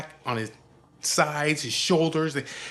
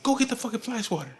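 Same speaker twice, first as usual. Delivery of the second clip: high, hurried mimicked command; irate order; 9 semitones higher, faster than usual. Father sending wife for fly swatter, irate order. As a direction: imitate fed-up bossing.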